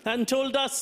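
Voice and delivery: usual speaking voice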